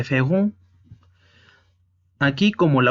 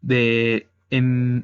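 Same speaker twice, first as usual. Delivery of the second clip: ordinary talk, nothing marked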